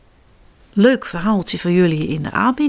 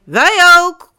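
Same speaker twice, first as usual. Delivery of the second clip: higher, louder call back; 9 semitones higher, 8 dB louder than usual. Acted. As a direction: parents shout back playfully. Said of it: "roepen papa en mama Beer terug". (ouders roepen luid terug)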